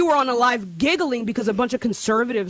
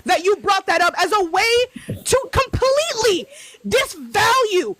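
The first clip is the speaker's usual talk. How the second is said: sarcastic yelling apology; 6 semitones higher than usual. Real, not acted. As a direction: yell the apology so it sounds like an attack. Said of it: "you do not need to yell at me".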